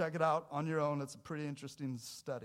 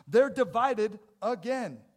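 loud and higher, driving each line; fervent preaching; 8 semitones higher, 14 dB louder, slower than usual. Acted. Pastor urging the church about doubt, loud and fervent. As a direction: preach with rising urgency.

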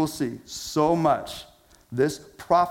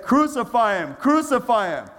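rulers calling for his death; mock crowd shout; 8 semitones higher than usual. Rulers demanding crucifixion, raised shouting voice.

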